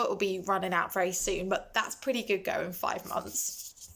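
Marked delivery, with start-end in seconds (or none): 2.7-3.9 soft unvoiced echo; hushed repeated afterthought; whispered afterthought. Real, not acted. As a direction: breathe the phrase to yourself. Quiet afterthought to herself.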